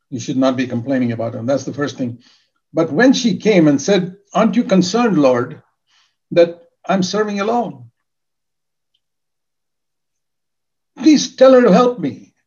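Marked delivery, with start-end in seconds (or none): 4.3-12.3 pleading complaint. Acted. Martha complaining to Jesus.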